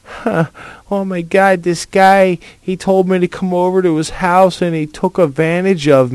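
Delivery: high, whiny mock girl voice; hammed-up girly victim voice; mocking teen girl. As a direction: parody a naive young girl online. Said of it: "sending I Ms through MySpace going".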